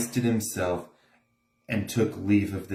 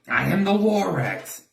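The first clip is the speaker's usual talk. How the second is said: Lorax voice; 9 semitones higher than usual. A narrator's reading. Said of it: "he coughed as he whiffed".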